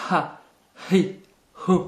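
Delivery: "Ha, hi, hu" each with a forceful exhaled H; strongly breathed H. Shown as a demonstration. Teacher showing each vowel on the letter, strongly breathed H. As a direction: push breath out hard to start "Ha, hi, hu". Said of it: "C'est un H expiré très prononcé".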